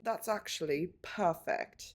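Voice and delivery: slow, soft British accent; understated British accent, unhurried; Harry Potter accent. Real, not acted. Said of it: "the Harry Potter accent comes out of me".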